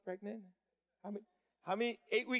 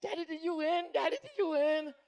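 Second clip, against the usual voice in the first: put-on much higher, pleading kid voices; eager kids; 9 semitones higher than usual. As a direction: ask like excited little children. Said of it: "they're like".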